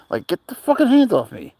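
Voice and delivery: high, exasperated mock rant; mock angry outburst. Retelling fans grabbing his hand, exasperated. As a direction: fling off their hands in disgust.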